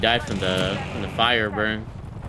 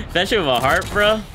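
excited; 8 semitones higher, 3 dB louder than usual. Real, not acted. Excited gaming remark.

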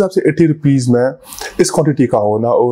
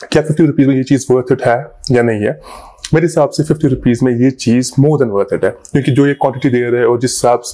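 chewing between words; talking after a bite. Real, not acted. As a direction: mouth partly full. Seen 1: spoonful of cream taken at 1.3, then he speaks while chewing.

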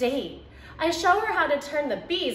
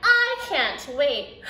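ordinary delivery

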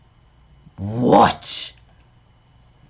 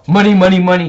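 loud, higher happy chant; excited; 7 semitones higher, 5 dB louder, much faster than usual. Real, not acted. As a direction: cheer for the money. Game reward cheer.

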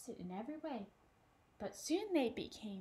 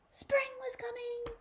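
high, loud joyful announcement; spring is here, high and bright; excited; 12 semitones higher, 7 dB louder than usual. A narrator's reading. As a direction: burst out with the good news. Happy news in the story.

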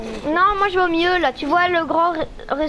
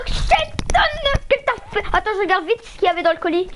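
furious shouting; 9 semitones higher than usual. Real, not acted. Losing it in game.

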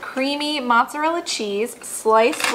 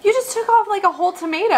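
surprised; 10 semitones higher, faster than usual. Real, not acted. Laughing at friend's bite.

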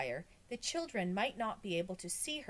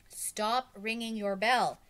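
slower, deliberate speech, raised but not shouted; relaying the message; 4 semitones higher, 7 dB louder than usual. A narrator's reading. Boy relays the crowd's words to his teacher, deliberate delivery. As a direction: repeat it plainly and deliberately so she understands.

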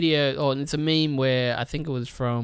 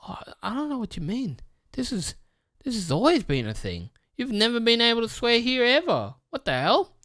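high, fast, innocent feigned confusion; high mock-innocent corporate voice; mocking YouTube; 9 semitones higher than usual. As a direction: play dumb like a company faking innocence, high and quick. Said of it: "can just come in and be like".

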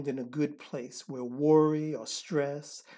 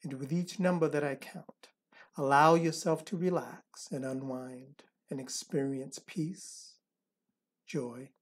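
soft spa whisper; 3 semitones lower, 8 dB quieter than usual. Acted. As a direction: guide them into calm, breath by breath. Leads a counted relaxation meditation.

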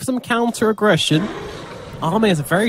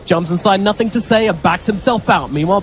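ordinary delivery

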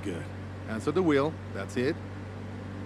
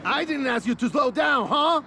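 furious shouting; 4 semitones higher than usual. Acted. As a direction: tear into her and fail her. Instructor berates student after crash.

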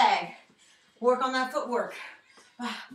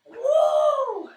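whoop; 7 dB louder than usual. Real, not acted. Workout cheer.

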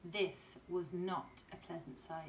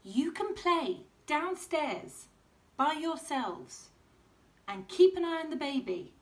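lightly voiced tired mum; mum's voice; 8 semitones higher, 8 dB louder than usual. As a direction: shoo the kids lightly.

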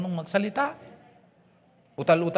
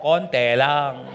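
put-on Pangasinan accent; 5 semitones lower, 4 dB louder, much slower than usual. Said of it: "Tapos may punto".

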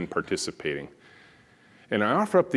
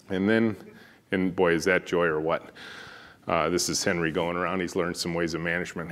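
normal talking voice

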